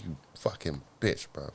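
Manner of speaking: muttered low and soft under the breath; hushed retold inner outrage; quiet inner curse. Recalling thought on finding out cheating.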